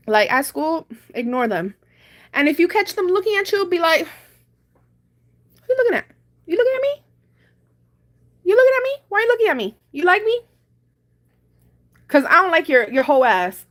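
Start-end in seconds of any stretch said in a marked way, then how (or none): 4.0-13.7 high-pitched mock challenge to someone staring; confrontational. Advising a viewer on facing starers at school, confrontational. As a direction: square up to them, pitched way up.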